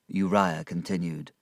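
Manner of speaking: ordinary delivery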